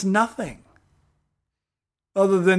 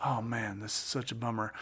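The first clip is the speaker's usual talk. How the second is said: soft, deflated sigh of a line; gently sad, much softer, voicing sympathy; mild disappointment; 6 semitones lower, 14 dB quieter than usual. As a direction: say it like a quiet letdown. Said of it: "we should be like".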